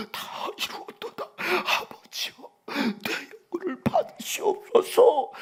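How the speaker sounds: breathy, slow, sobbing last words; gasping, dying cry. Retold or imitated. Preacher voicing Jesus on the cross, gasping and sobbing. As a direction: gasp each word out with a break in the voice. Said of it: "숨을 헐떡거리시면서".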